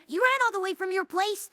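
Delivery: ordinary delivery, nothing marked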